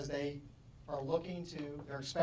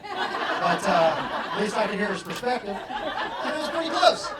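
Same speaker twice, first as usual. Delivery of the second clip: louder and higher, laughing through it; 5 semitones higher, 12 dB louder, slower than usual. Real, not acted. Telling the class the ending, laughing louder and higher. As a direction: laugh as you admit it.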